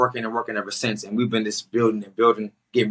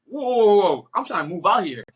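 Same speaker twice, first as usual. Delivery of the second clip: acted alarmed protest; 7 semitones higher than usual. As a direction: halt them in mock alarm, then plead your case. Acted protest at being sent home.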